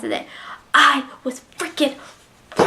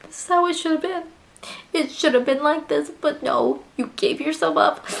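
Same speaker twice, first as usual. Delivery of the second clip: retold crying; 4 semitones higher, 4 dB quieter than usual. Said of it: "I'm crying because I was like".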